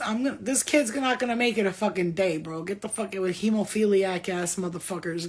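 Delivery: normal talking voice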